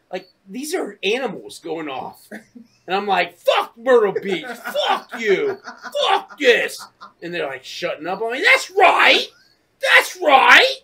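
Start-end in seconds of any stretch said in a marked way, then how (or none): 3.4-6.8 loud, raised-pitch cursing; re-enacted ranting at the whole town; mad. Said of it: "I was so mad, dude". 8.4-10.8 high, loud defiant yell; re-enacted belligerent holler; mad. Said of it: "I was so mad, dude".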